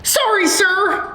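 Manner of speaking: high schoolboy apology; high-pitched, flustered pupil; anxious apology. Acted. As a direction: blurt sorry to a strict teacher. Pupil told off for talking.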